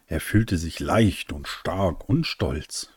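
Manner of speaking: plain reading voice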